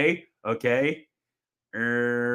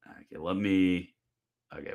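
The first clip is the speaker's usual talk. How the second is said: ordinary talk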